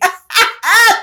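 high loud laugh. Real, not acted. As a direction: keep cracking up. Still laughing.

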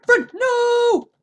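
high loud scream of no; shrill loud wail of defeat; desperate scream. Real, not acted. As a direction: scream it as you die. Killed by the attacker.

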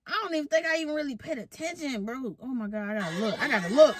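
ordinary delivery

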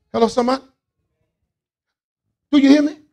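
loud, high call to the room. Real, not acted. Preacher to congregation, loud call. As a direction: rouse the crowd.

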